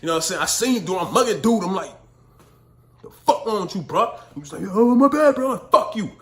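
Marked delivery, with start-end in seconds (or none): 3.0-4.2 retold glare and snap. Mugging the stranger at the store. 4.7-5.5 much higher and louder, put-on apologetic voice; imitating the old man. The old man apologizing to him at the store, high put-on voice. 5.7-6.1 high and loud dismissive shot back; loud retort. Retold or imitated. Telling the stranger off at the store, loud retort.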